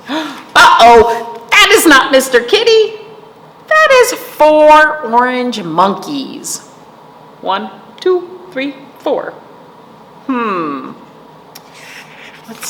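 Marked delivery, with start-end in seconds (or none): 0.1-6.7 loud, high storybook reveal; quick shock, then draw out the discovery; hammed-up uh-oh for kids; mock alarm.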